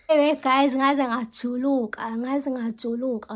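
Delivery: loud complaint. Real, not acted. Complaining loudly of sweating.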